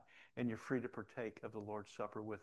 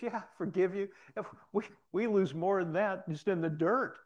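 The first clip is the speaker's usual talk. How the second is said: laughing forgiveness; 8 semitones higher, 7 dB louder than usual. Retold or imitated. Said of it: "He's laughing".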